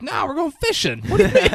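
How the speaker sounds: high, loud, mock-cheerful refusal; Gil's voice. Gil refusing to bring Bobby's kid back, mock-gleeful. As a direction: imitate an overexcited man brushing off a worry. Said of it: "as Gil's doing that voice".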